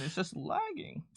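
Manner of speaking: normal talking voice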